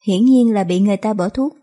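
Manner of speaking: ordinary delivery, nothing marked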